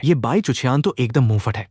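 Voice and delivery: usual speaking voice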